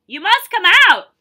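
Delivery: loud, high yell of a frightened trainer. A narrator's reading. Trainer yelling from the boat to the swimmer, loud and high. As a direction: bellow it across the water. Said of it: "He yelled".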